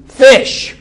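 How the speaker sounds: high, loud punch on one word; emphatic exclamation. Real, not acted. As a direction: burst out with the obvious answer. Answers own rhetorical question loudly.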